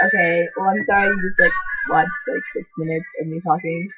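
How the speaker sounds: normal talking voice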